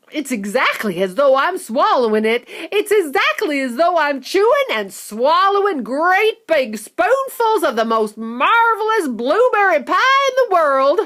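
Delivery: put-on a child marvelling at every bite; giddy young girl savouring the taste; excited girl. Violet gushing about the gum.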